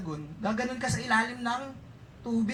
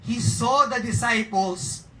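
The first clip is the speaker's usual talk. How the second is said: very slow, louder reading; scripture reading; 7 dB louder, much slower than usual. Acted. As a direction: lean into each word slowly.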